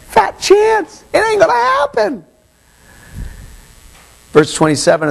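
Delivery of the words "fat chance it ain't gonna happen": mocking shout. Real, not acted. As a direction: shout it down as impossible. Preacher mocking the slave's promise.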